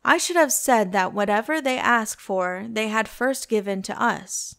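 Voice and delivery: usual speaking voice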